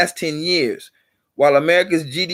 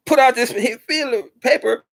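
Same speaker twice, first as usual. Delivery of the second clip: ordinary talk, nothing marked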